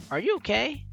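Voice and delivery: ordinary talk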